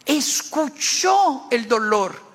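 high, forceful sermon delivery; impassioned preaching. Acted. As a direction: preach it with rising force, drawing out key verbs.

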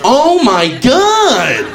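high, very slow mock horror; exaggerated dismay. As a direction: draw it out, appalled at the sight. Appalled at huge bird mess.

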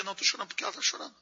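quick, hushed imitation of a woman crying along; hammed-up sympathetic crier, fast and hushed; mock weepy woman. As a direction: rattle it off softly like a silly crier. Mocking an emotional woman's reply.

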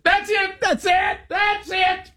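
high-pitched yelping repeats; hammed-up, very high exclamation; mock panicked cry. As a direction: squeal it out, way up high. Acting out calling off the night.

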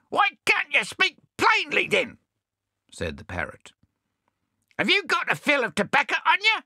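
very high, squawking shout; bad-tempered parrot. Cranky swagman parrot shouting at Bill. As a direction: snap back in a squawk.

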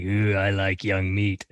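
low, slow creepy leer; lightly voiced lecherous old man; a hint of a leering creep, drawn out. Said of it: "he's all creepy into her, like".

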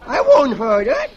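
put-on high, loud, whiny kid; whiny high protest. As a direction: squeal it defiantly. Kid grabs the bus wheel.

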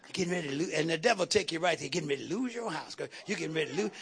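low, soft taunting devil; lightly voiced devil mocking; devil's taunt. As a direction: sneer it low like the tempter. Preacher voicing the devil's taunt.

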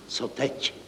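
very soft voiceless whisper. Acted. Deputy accountant asks the room, whispering. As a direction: whisper it helplessly, barely audible. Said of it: "zašeptal zástupce hlavního účetního".

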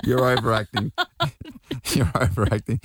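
cracking up while scolding gently; laughing teasing rebuke. Real, not acted. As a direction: chuckle through the words. Laughing at son's fake excuse.

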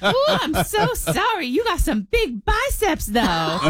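put-on high, slow sweet old-lady voice; grandma voice. Granddaughter imitating flirty grandma, high slow coo. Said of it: "she literally was like".

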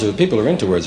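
usual speaking voice

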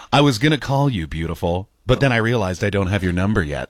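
straight-faced chat-up, sinking low; pickup-line voice. Acted. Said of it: "why do I do it in that voice".

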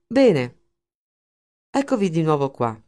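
biting sarcastic greeting, stressed first word. A narrator's reading. Linder greets his silent men, sarcastic. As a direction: greet them with open sarcasm. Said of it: "La sua voce trasudava sarcasmo".

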